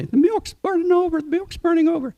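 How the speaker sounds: very high, squawking bird voice; shrill parrot squawk warning of boiling milk. Acted. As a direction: screech it out like an alarmed parrot. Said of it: "they squawk".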